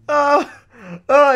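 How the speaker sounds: loud, high sobbing wails; mock wailing. Player crying over his departed villager, loud wailing. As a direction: bawl it out like a kid on his bed. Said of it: "started crying so much".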